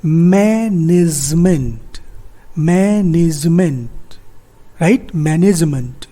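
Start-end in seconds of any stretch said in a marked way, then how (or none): 0.0-3.9 management drawn out slowly, man-ij-ment; slow pronunciation demo. Shown as a demonstration. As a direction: stretch each syllable of management for learners. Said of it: "we pronounce it".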